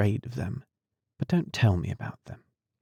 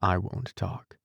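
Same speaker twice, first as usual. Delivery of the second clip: plain reading voice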